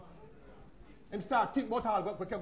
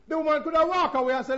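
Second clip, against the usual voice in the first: raised repeat; 5 semitones higher, 7 dB louder than usual. Acted.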